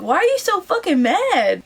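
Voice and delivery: high, drawn-out disbelieving question; acted-out bafflement; incredulous retort. Acting out her incredulous retort.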